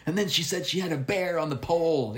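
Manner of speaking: high, put-on anecdote voice; telling friends. Performer tells friends the funny bear story, in a high mock-amused voice. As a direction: mimic yourself retelling a silly story. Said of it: "a funny story after the show to tell my friends".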